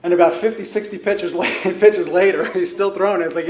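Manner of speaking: usual speaking voice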